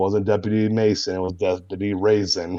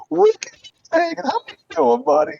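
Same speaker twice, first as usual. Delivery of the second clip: very high, louder nerdy greeting; Nick Riley voice; 5 dB louder than usual. Acted. Nick Riley jumps out to greet his buddy Ricky, very high nerdy voice. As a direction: jump out and greet your buddy like an eager nerd. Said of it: "It's the voice".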